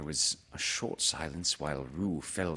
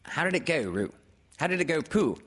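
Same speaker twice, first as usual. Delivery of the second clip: high, prompting character voice; quick nudge, pitched up; Rabbit; 9 semitones higher, 4 dB louder, faster than usual. Acted. Rabbit prompting Pooh to recite, in a high voice. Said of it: "said Rabbit".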